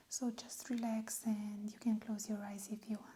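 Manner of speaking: soft unvoiced whisper, close to the mic; whispered asmr role play. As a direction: whisper it gently like a calming doctor. ASMR exam, whispered instructions.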